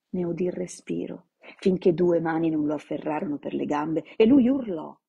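ordinary delivery, nothing marked

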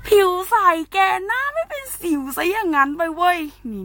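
high, gushing boast about clear skin; excited bragging. Real, not acted. Vlogger bragging to viewers about her skin, high excited squeal. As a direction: squeal it like you can't believe your luck.

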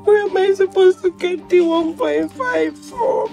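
tearful pleading. Acted. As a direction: pour out desperation through tears. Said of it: "stop crying now".

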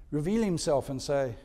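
usual speaking voice

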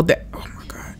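whispering. Real, not acted. Whispered fright.

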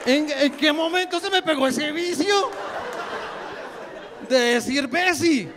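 high, plaintive complaint; mock lament. Comedian laments his new verbal habit, high mock dismay. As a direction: whine upward in exaggerated dismay.

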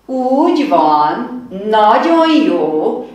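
loud, slow, beaming praise with a laugh; delighted teacher praise; enthusiastic praise. Acted. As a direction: cheer the kids on warmly.

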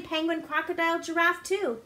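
ordinary delivery, nothing marked

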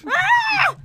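high, loud startled shriek; startled scream. Real, not acted. Player suddenly attacked in the game, startled scream. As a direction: scream as if ambushed.

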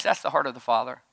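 ordinary talk

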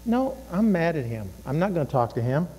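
low, soft grumbled self-talk; quiet, quick sullen refusal; sulky inner voice. Acted. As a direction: sulk privately under your breath. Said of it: "You know, the voice that says".

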